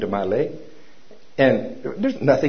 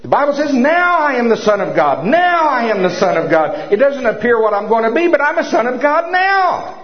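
full-force preaching; 11 semitones higher, 4 dB louder than usual. Acted. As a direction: proclaim it to the whole church.

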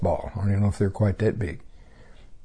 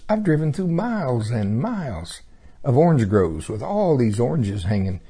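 normal talking voice

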